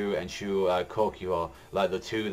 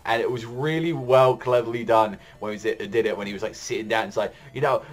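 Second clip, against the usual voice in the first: ordinary talk, nothing marked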